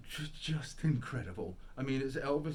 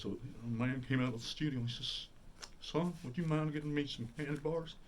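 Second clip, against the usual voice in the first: imitated Southern tribute narration; 4 semitones higher than usual. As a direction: tell it as a hammy King impersonator. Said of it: "he tells it in the [name] voice".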